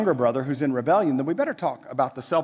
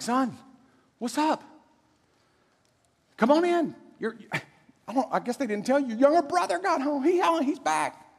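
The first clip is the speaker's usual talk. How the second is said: higher, gentle coaxing dad; coaxing father; 8 semitones higher than usual. Retold or imitated. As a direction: coax the sulking son inside. Father coaxes son outside.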